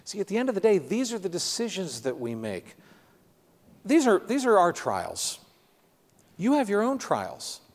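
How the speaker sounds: high, pressing pulpit voice, slowing on trials; preacher lifting his voice to the church; impassioned preaching. Acted. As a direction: drive it home to the congregation. Preacher turns to congregation.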